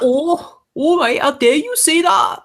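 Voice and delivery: high-pitched, hammed-up Geordie indignation; Geordie accent. Co-host mimics an outraged Newcastle local, high Geordie accent. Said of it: "the language of the Geordie".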